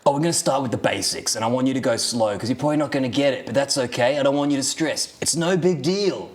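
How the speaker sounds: lightly put-on Australian teacher, reassuring; a breezy Australian teacher calming a pupil; Australian accent. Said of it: "terrible Australian accent".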